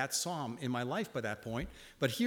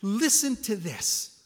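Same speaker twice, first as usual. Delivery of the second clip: urgent; 6 semitones higher, 6 dB louder, much slower than usual. Retold or imitated. Insisting to wife.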